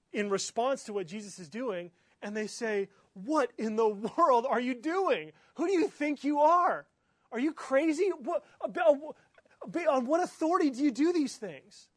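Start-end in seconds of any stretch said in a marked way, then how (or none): 3.1-11.9 much higher and louder, outraged challenge; indignant leaders. Retold or imitated. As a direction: hurl it at him like offended officials. Leaders confront Jesus, acted quarrel.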